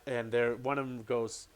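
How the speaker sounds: usual speaking voice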